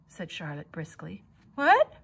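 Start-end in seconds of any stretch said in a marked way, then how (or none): 1.5-2.0 high loud hopeful cry; surprised cry. Acted. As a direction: burst out in hope. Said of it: "cried Wilbur".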